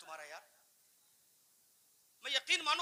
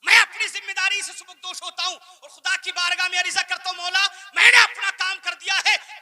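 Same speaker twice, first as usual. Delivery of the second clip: raised, forceful preaching voice; loud declaration; 7 semitones higher, 17 dB louder than usual. Real, not acted. Preacher telling God his duty is done, loud and impassioned. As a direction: proclaim it out loud before God.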